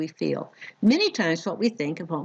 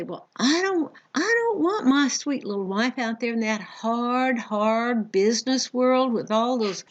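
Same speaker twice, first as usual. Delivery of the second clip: lightly voiced Southern man, a touch higher; Southern accent; 4 semitones higher than usual. A Southern man talking down about his wife, in a light Southern accent. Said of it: "speak in my Southern accent".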